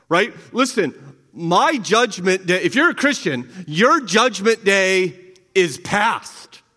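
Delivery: normal talking voice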